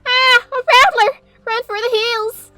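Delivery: high loud panicked cry; panicked shriek. Acted. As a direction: shriek in terror. Granny scared by fake snake.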